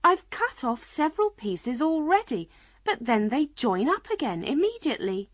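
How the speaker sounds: put-on high-pitched girl's voice; young Alice. A narrator's reading. Alice explaining her trouble with the cake, high girlish voice. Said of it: "said Alice".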